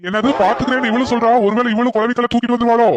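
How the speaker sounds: high-pitched, worried wondering; comic alarm. Acted. Husband fears his wife may attack him too, alarmed.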